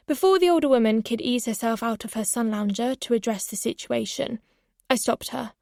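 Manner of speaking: plain reading voice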